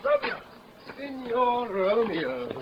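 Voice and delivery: shouting for a friend who's hiding; calling out. Acted. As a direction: hail him from afar, stretching Signor slowly. Calling for Romeo in street.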